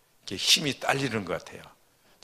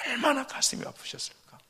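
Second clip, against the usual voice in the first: 얼마나 가슴이 아프셨을까 repeated much higher, aching; anguished echo. Shown as a demonstration. A pastor imagining God's heartbreak, high and aching. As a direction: lift 얼마나 high and let the line ache.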